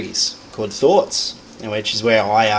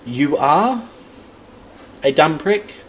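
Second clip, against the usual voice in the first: "are" drawn out and raised, then a pause; "You are" lifted before "a dumb prick"; stress on ARE; 4 semitones higher, slower than usual. Shown as a demonstration. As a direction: lean on "are" and hold it. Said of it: "that word that you emphasize is the subject".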